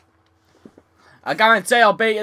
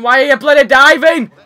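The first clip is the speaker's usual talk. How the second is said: much louder and higher, yelling the question; angry shout; 6 semitones higher, 10 dB louder than usual. Real, not acted. Gamer yells at his tennis character, furious shout. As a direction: blow up at the screen.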